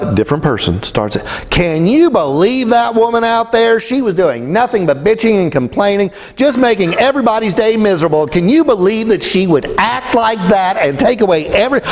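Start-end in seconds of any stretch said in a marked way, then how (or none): 1.5-11.8 higher-pitched outraged complaining, imitated; mock indignant gossip. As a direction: play the scandalised patient griping about the ranter. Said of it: "in about this tone of voice".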